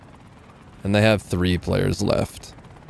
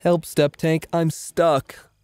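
higher, whiny damsel-in-distress voice; mock helpless plea; 8 semitones higher, much slower than usual. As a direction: play a helpless little voice. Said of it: "Cursed statement of the day".

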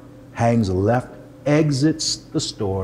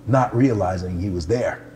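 usual speaking voice

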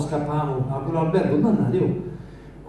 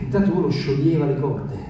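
normal talking voice

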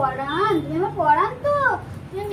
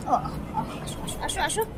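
whispered coaxing; 3 semitones lower, 8 dB quieter, faster than usual. Real, not acted. Softly calling pet. (ফিসফিস করে ডাকা)

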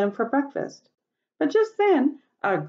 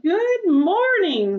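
ladybug voice; 12 semitones higher, 4 dB louder, much slower than usual. A narrator's reading. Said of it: "said the friendly ladybug".

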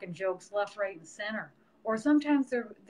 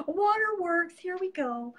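high, louder mock-dramatic announcement; hammed-up squeal about starting to cry; mock self before crying; 10 semitones higher, 4 dB louder than usual. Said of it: "I'm just like".

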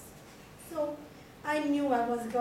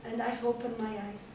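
ordinary delivery

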